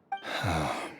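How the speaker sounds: a quiet sigh, breath sinking away; soft resigned sigh. Acted. Husband sighing after admitting he clings to life, soft resigned sigh. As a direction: sigh as if letting go.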